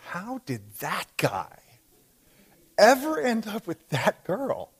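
slow, baffled disbelief, pausing mid-question; incredulous wondering. Acted. Acted thought about a mismatched couple.

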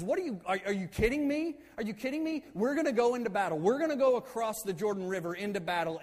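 mock outraged disbelief, pitched high; freaking out. Moses to tribes who want to stay behind, freaking out. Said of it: "He absolutely freaks out".

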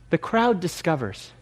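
ordinary talk, nothing marked

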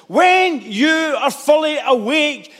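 drawn-out, weighty delivery; slow it down to let it land; slow emphatic. Acted. Repeated line, slowed down.

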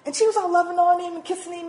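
ordinary delivery

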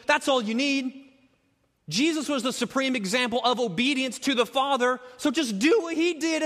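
high, insistent mock impatience; exasperated, high-pitched pleading; blunt exasperation. Acting out Paul being blunt.

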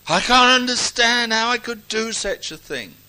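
loud, high wail of self-reproach; mock dismay. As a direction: lament it in a hammed-up, high voice. Acts out a believer's self-reproach.

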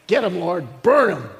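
loud, punchy hammed-up plea; mock vengeful shout. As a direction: cheer on judgment, exploding at the end. Acting out eagerness for judgment.

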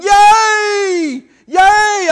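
high, loud imitated cheer; retold crowd cheering. Preacher imitating the village crowd, cheering loudly. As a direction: shout it like a whole village cheering. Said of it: "started shouting".

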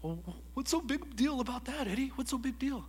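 high, dismissive mock question; skeptical listener. An imagined listener scoffing at him, high and dismissive. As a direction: scoff like a doubter.

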